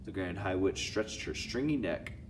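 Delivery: usual speaking voice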